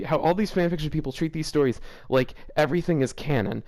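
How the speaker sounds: usual speaking voice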